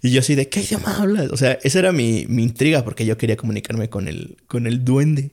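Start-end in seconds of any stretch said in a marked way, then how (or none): 0.5-1.3 grito retold. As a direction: imitate yelling a question in a dream, high-pitched. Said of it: "Le estaba gritando como". (imitando un grito, agudo pero sin fuerza)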